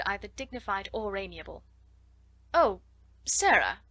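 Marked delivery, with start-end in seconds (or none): 2.5-3.8 startled exclamation, louder on the name; exclaimed. A narrator's reading. Miss Minchin finding Sarah in the hall, exclaiming. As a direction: caught off guard, swooping down on the name. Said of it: "she exclaimed".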